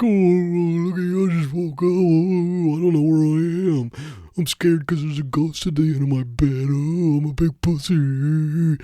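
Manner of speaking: a ghost mocking you from the end of the bed; high, loud mocking ghost; Roast Ghost. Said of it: "character I wanna do called Roast Ghost".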